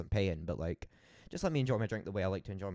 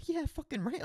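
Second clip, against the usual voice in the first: high, eager yes; thrilled to grab the drinks; excited; 3 dB louder than usual. Retold or imitated. Eagerly accepting free drinks.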